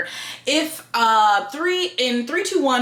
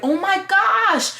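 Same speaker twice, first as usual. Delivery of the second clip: surprised; 10 semitones higher, slower than usual. Retold or imitated. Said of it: "you're like".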